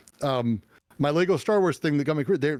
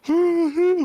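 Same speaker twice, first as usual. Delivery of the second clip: a sudden high, loud sobbing gasp; imitated sob; 7 dB louder than usual. Woman bursting into tears after the exhibit, imitated sob. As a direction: mimic her breaking down in one loud sob. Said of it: "like, sobbing and".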